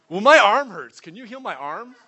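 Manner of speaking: high, loud imitation of an excited girl; eager demand. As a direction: burst in with an excited plea. Retells friend eagerly asking for healing.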